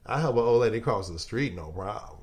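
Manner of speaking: mimicking his friend. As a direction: put on the buddy's voice as he admits his faults. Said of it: "He like".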